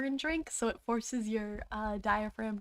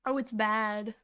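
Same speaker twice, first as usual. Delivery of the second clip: normal talking voice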